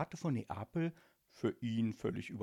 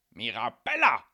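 bellowing for his daughter; angry father's loud summons; full-volume yell; 11 dB louder than usual. A narrator's reading. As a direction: yell the name, landing hard on the end. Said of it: "schrie Don Calzone so laut er nur konnte".

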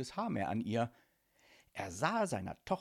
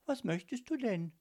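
high-voiced courteous daughter; lightly inquiring child, pitched high; polite young girl; 10 semitones higher than usual. A narrator's reading. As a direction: ask politely in a high girl's voice. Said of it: "sagte Miri höflich".

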